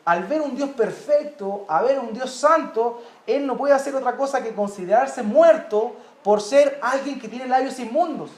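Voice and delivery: ordinary talk, nothing marked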